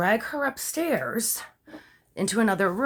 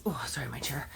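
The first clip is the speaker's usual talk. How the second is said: real whisper under her breath; whispered aside; 7 semitones lower, 10 dB quieter than usual. Real, not acted. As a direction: drop to a whisper for a quick apology. Aside about her chair.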